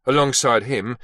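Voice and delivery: ordinary delivery, nothing marked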